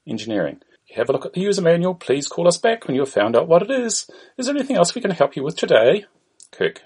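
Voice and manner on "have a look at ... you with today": put-on higher-pitched Indian accent; Indian-accented call centre greeting. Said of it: "An Indian voice is heard".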